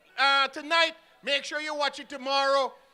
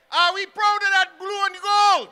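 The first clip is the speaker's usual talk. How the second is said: rally shout; 5 semitones higher, 9 dB louder than usual. Real, not acted. Rally call to the crowd.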